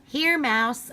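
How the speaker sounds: put-on high, friendly kid calling a cat; child calling the cat. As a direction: offer the ham like a happy child.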